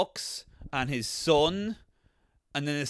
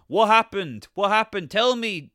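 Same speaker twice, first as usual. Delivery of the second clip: mock urgent demand; 4 semitones higher, 7 dB louder than usual. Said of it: "And like".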